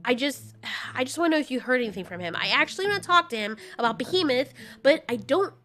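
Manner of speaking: rushing to ask without seeming pushy; anxious Mothra. Acted.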